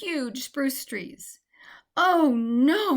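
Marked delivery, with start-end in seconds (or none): 2.0-2.9 high, loud dismay; lost mouse in the dark forest, alarmed cry. A narrator's reading. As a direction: gasp it out in alarm. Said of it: "Michaela cried".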